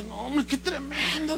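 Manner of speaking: soft, slow, hushed remark; hushed dismay. Retold or imitated. Church member confides to the pastor, soft and slow.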